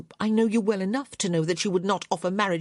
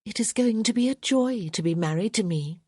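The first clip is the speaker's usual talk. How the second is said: sickly sweet irony; 5 semitones higher than usual. A narrator's reading. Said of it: "a sickly smile".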